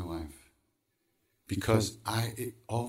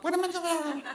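panicky inner voice. Acted. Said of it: "the other part of me says".

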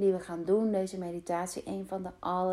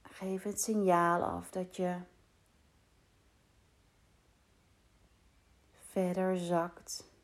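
guided meditation. Acted. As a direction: guide them calmly into rest. Leads a meditation. (rustige meditatiestem)

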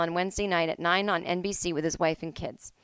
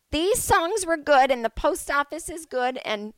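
put-on higher, slow little old lady voice; 8 semitones higher than usual. Host rambling on air, playing a little old lady. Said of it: "I feel like the little old lady today".

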